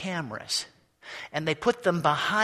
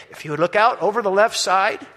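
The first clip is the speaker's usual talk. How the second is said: tour guide announcement; 3 semitones higher, 5 dB louder than usual. A narrator's reading. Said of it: "we hear a voice say".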